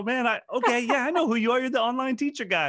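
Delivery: hammed-up dawning recognition; teacher suddenly recognizing. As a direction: voice the teacher going oh, it's you. Teacher voiced recognizing him.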